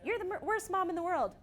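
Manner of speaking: high-pitched, lightly acted accusation; mock child outburst. Child sparring with mom, mock outburst.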